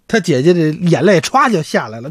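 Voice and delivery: ordinary delivery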